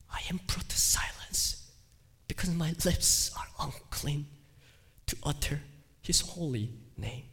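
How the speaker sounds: hushed whisper of a silenced man; soft whispered confession fading out. Acted. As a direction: breathe the words as if unable to speak. Isaiah silenced before God.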